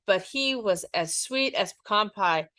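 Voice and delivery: ordinary delivery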